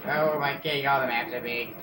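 ordinary talk

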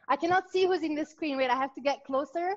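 normal talking voice

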